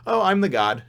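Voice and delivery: smug god line with a laugh; mock-smug villain, laughing. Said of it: "And he's just like".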